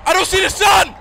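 high frightened yell; screaming in real panic; panicked shout. Real, not acted. Panicking, can't see the sun.

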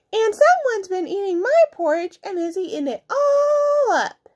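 high, upset little bear voice. A narrator's reading. Little bear finds porridge gone, high wail. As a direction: wail it high, drawing out the end.

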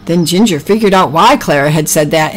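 plain reading voice

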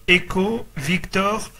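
ordinary talk

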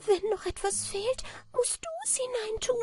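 very high, thin, sorrowful creature voice; sad little manikin. A narrator's reading. Little man has put everything in, sad high voice. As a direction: pipe it sadly in a tiny falsetto. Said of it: "sagte das Männlein traurig".

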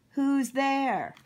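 high, loud call at the door. Acted. Cowboy playing along with the knock-knock joke, calling out. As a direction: holler it with the words drawn out.

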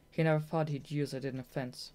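plain reading voice

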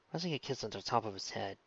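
ordinary delivery, nothing marked

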